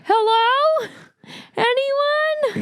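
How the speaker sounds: high, loud plaintive call; tied-up heroes call for help in mock despair. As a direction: call out for rescue, hammed up. Corpses calling for rescue.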